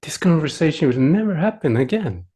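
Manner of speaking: ordinary delivery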